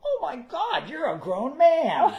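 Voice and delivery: high, loud, drawn-out gasp of surprise; astonished fan who can't believe it; shocked fan. Retold or imitated. Imitating a stunned fan after the show, high and loud. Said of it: "people come up to me and go".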